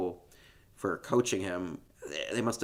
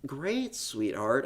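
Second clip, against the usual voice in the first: drawn-out, higher mock-mom praise; politely pleased mom; 6 semitones higher, slower than usual. Host as mother handed blackened toast, slow put-on delight. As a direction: feign delight at a burnt breakfast. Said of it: "She's like".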